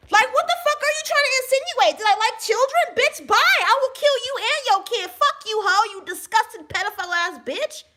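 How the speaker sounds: high, loud furious rant; mock outraged retort. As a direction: snap back, offended and fed up. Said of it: "They'll do shit like that".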